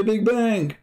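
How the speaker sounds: mocking quote. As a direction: parrot their stock answer in a silly high voice. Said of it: "they say".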